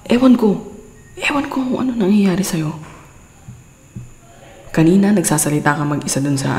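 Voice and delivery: usual speaking voice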